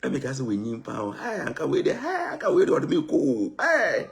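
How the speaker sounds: high, loud begging to Charlie; high pleading plea to Charlie; pleading friend voice. Retold or imitated. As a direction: beg Charlie loudly in a high voice. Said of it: "they talk, say".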